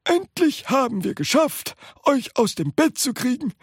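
put-on high old-man voice ending in a chuckle; teasing old man. A narrator's reading. Grandpa laughing at bedridden relatives, teasing.

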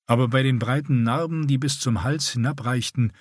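usual speaking voice

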